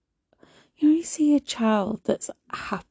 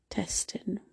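weary whisper; 9 dB quieter than usual. Real, not acted. Exhausted by emotions.

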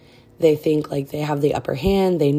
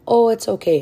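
mocking in-laws; 7 semitones higher, 5 dB louder than usual. Mocking in-laws' excuse.

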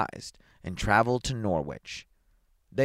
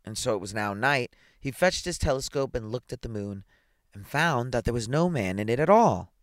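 usual speaking voice

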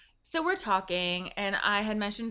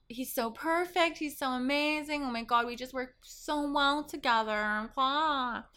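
higher, dreamy gushing; gushing girlfriend; 7 semitones higher than usual. Retold or imitated. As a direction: swoon over him mockingly.

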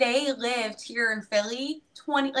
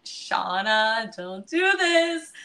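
emotional self-talk; much slower than usual. Retold or imitated. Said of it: "that just made me emotional".

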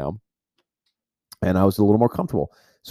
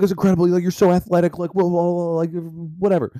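higher, gushing hyped friend; pumped buddy; 9 semitones higher than usual. Retold or imitated. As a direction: rave like an amazed buddy.

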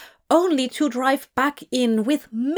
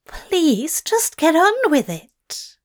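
high, loud embarrassed plea; pleading; 7 semitones higher, 4 dB louder than usual. Acted. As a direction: beg him, flustered and high. Mortified angel pleads.

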